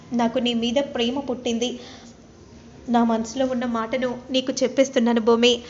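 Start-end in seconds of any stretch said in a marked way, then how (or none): none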